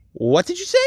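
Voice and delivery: very high, disbelieving squeal of a question; acted shock, leaping upward; incredulous question. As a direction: shoot the pitch way up in disbelief. Acted shocked question after slip.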